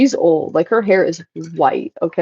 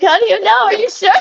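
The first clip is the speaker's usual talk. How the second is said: mock doubting coworker; 13 semitones higher than usual.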